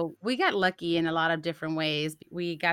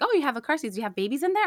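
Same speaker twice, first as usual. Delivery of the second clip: store clerk's question; 7 semitones higher, faster than usual. Retold or imitated. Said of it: "she's like".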